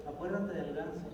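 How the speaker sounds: hushed, sly blackmail; quiet threat. A narrator's reading. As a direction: sister quietly reminding brother of his secret. Sister repeats secret threat.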